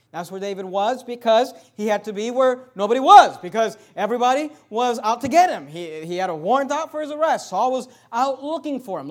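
loud, rising sermon voice; preaching at full force. Acted. Preacher building up his church. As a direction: preach it out to the congregation.

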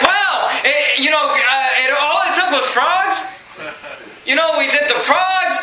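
high, laughing shout of victory; excited Moses. Acted. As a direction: crow that the frogs did it. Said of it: "he's excited 'cause".

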